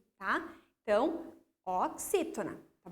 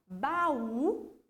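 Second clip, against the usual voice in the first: baú with the voice raised on the ú; stress on final U. Shown as a demonstration. Teacher giving pupils an example, baú with a stressed final ú. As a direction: lean into the last syllable of baú, the ú. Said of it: "eu aumento o tom de voz no U".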